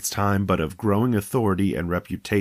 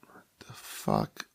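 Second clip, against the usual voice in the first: breathless disbelieving whisper; 13 semitones lower, 11 dB quieter, slower than usual. Real, not acted. Whispered reaction.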